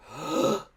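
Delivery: gasp for air. Acted. Surfacing gasping for air.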